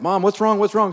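retold concern, pitch lifted; worried son. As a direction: ask mom urgently what's wrong, lightly acted. Worried son on phone to crying mom.

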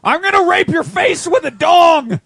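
high, drawn-out comic holler; mock crazed shout. Host mocks disturbing bedroom talk, mock crazed shout. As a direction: bellow it like absurd dirty talk.

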